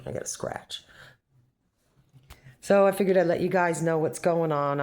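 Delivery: ordinary talk, nothing marked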